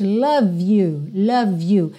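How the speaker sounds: "love you" said as one word, V running into "you"; V linked to you. Shown as a demonstration. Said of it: "eu já ligo o V no you". ("love you" ligado, o V emendado no you)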